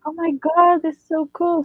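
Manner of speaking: high-pitched retold gushing; excited fangirl quote. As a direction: imitate a thrilled fan's reaction. Retelling a girl's excited reaction.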